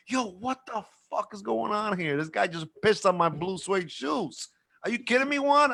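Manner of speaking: high, incredulous complaint climbing to the name; mock indignation, rising to a high peak; acted outrage. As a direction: imitate a man aghast at his ruined shoes. Marvin outraged over his soiled shoes.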